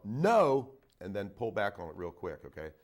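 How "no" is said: loud, higher, drawn-out no; yelled command. Shown as a demonstration. Vet showing a dog owner the correction, yelled. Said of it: "you're gonna yell".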